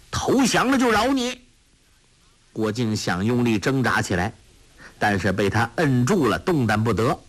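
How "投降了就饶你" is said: much higher bully's shout; shouted threat. A narrator's reading. Dushi pummels Guo Jing, shouting threat. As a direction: bark the demand to surrender. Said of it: "喝道".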